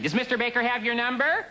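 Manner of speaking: high, prim secretary voice; mimicking secretary. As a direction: play the prissy receptionist. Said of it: "They always say the same thing".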